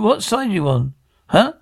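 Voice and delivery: ordinary talk, nothing marked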